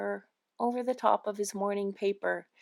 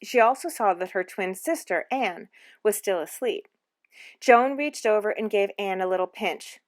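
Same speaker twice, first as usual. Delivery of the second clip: ordinary delivery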